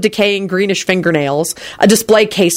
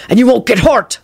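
higher-pitched robber's threat; threatening yell; 5 semitones higher, 3 dB louder than usual. A narrator's reading. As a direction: menace him in a raised pitch. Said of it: "the man yelled threateningly".

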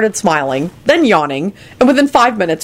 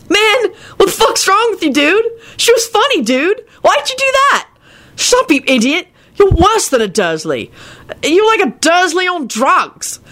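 much higher, ranting slacker; high outraged stoner; 13 semitones higher than usual. Acted. Kenny ranting.

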